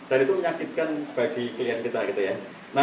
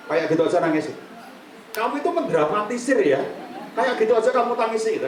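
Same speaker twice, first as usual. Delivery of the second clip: dismissive husband; 6 semitones higher than usual. Acted. Husband to crying wife, dismissive scolding. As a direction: brush her tears off as drama. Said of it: "suami yang tidak ngerti kesedihan istrinya".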